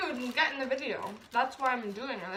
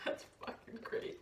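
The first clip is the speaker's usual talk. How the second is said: breathless laugh; 10 semitones higher, 12 dB quieter than usual. Real, not acted. Laughing quietly after mishap.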